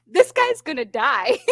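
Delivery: high, drawn-out mock alarm; amused horror, slow and high; laughing retold alarm. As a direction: stretch it out high, breaking into laughter.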